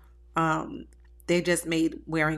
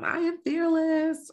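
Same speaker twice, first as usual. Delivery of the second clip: boastful; 11 semitones higher, slower than usual. Retold or imitated. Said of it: "I would be like".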